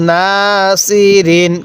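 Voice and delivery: না held long, then level সি রিন; recitation model. Shown as a demonstration. Teacher showing how to read, drawn-out না সি রিন. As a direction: stretch না and chant সি রিন evenly. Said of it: "কিভাবে পড়ব".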